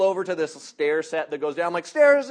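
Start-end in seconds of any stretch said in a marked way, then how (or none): 1.8-2.3 high, loud warning; mock alarmed shout. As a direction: yell it as danger looms. Retold alarm at stairs.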